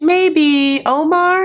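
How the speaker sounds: high, drawn-out hopeful question; mock tentative asking. Avery asking Omar about a drink, mock tentative. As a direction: stretch it out high, as if seeking permission. Acting out a hesitant request.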